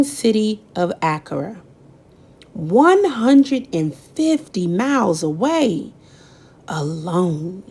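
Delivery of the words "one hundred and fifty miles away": usual speaking voice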